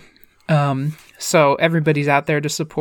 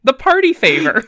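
mom explaining; 7 semitones higher than usual. Retold or imitated. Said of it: "And she goes".

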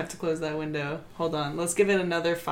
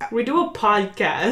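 raised, louder voice; emphatic protest; 3 semitones higher, 8 dB louder than usual. Real, not acted. Player reminding a friend it's a podcast, loud. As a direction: remind them, exasperated and loud. Teasing argument over seeing pictures.

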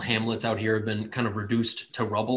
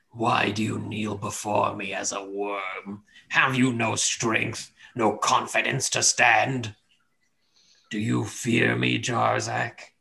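cold rasping evil emperor; slower than usual. Acted. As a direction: menace him slowly like a dark deity. Deity voice taunts Jarzak.

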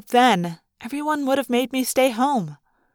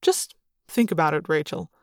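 Chapman; 7 semitones lower, 4 dB quieter than usual. A narrator's reading. Chapman's voice closing his pitch.

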